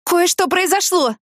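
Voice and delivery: высоко и протяжно, в панике; выпали новость высоким голосом, растягивая слова; взволнованный возглас. A narrator's reading. Паникующий звонок подруге. (встревоженно)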